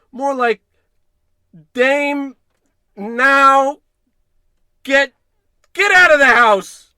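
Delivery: very high, very loud yell. Acted. George yelling at Marge to get out of the house, very high and loud. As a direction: bellow after her at the door. George yells as she leaves.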